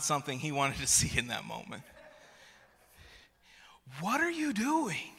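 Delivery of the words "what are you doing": very high, strained squeal at normal volume; hammed-up frustration, held back from a yell; dialled-down exasperation. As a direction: squeak it out, reining in the outburst. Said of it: "maybe we can dial this down just a little bit".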